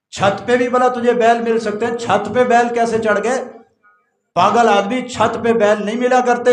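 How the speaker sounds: loud, high, scornful rebuke; scolding shout. Acted. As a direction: scold him for being a fool. King scolds the man loudly. (king scolds the man on his roof, in a loud mocking shout)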